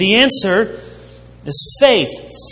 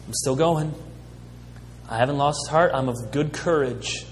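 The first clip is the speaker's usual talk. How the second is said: softer, steady and sure; quiet resolve; 4 semitones lower, 9 dB quieter than usual. Retold or imitated. Paul tells the church he's still going, softly. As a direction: say it gently, with settled courage.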